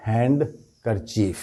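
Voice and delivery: "ہینڈ کرچیف" split, with the D sounded; common mispronunciation. Shown as a demonstration. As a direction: say "ہینڈ کرچیف" as hand... ker-chief. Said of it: "اکثریت ہے وہ اس طرح سے بولتی ہے". ("ہینڈ کرچیف" میں ڈی کی آواز کے ساتھ)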